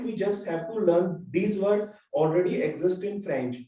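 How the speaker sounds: normal talking voice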